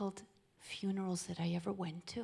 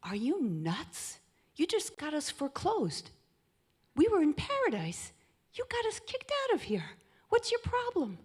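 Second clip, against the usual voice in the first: high, louder, exasperated scolding; shrill-free pretend tirade at a wife, much higher; mock angry rant; 9 semitones higher, 6 dB louder than usual. Adam blaming the woman after paradise, mock furious and high. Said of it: "He could have said to her".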